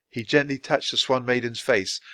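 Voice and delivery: normal talking voice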